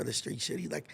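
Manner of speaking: ordinary talk, nothing marked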